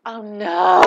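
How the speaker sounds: mock dismay at the proposal. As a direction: react with comic dread. Shocked quoted reaction.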